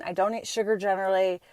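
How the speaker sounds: ordinary delivery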